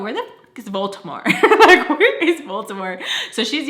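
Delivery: laughing through the question; real laughter breaking the words. Real, not acted. Laughs while telling the story.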